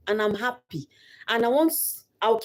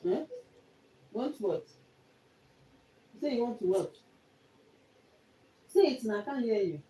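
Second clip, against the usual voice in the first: quiet coaxing; 5 semitones higher, 6 dB quieter than usual. Real, not acted. Asking crying child what's wrong.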